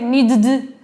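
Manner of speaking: "needed" as need-d, no vowel between the d sounds; wrong "needed" with its d sounds jammed together; missing middle vowel. Shown as a demonstration. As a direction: stutter the final d of "needed" without the "i". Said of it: "necesito poner esa i en el medio".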